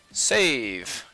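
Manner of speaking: usual speaking voice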